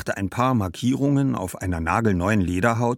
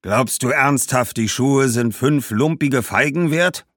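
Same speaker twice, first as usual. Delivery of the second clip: loud, raised, scornful merchant; indignant; 7 semitones higher, 6 dB louder than usual. Acted. Shoemaker scorning five figs for his shoes, indignant. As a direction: scoff at the paltry offer.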